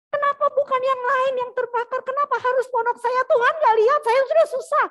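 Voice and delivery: loud, high-pitched angry complaint; angry protest. Retold or imitated. Castaway whose hut burned rails at God, angry protest. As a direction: rail at heaven, acted at full volume. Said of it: "dia marah sama Tuhan".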